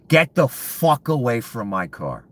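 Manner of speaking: man yelling at strangers by his car; angry yell. Retold or imitated. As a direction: bellow each word to drive them off. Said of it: "I'm yelling at them".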